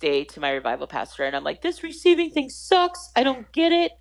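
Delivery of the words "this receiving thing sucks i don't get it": high-pitched mock tantrum; exasperated complaint. Student complaining to her revival pastor, high exasperated outburst. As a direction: whine it loudly in hammed-up frustration.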